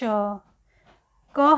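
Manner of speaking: क हलंत है drawn out slowly; teacher stretching each sound. Shown as a demonstration. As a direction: linger on क हलंत है. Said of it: "stretches halant sound".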